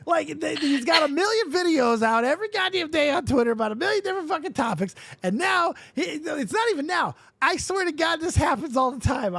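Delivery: real incredulous outburst; exasperated rant. Real, not acted. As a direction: rant at the guy who is everywhere. Exasperated rant about Deaf Noodles.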